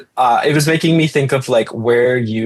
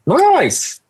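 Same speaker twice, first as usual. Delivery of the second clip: much higher, a playful squeal; squeaky high nice. Acted. Echoing her own nice, squeaky high for fun. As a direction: pop the word Nice way up high.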